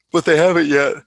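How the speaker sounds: talking through a yawn; words stretched by a yawn. Real, not acted. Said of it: "I'm just yawning a lot today".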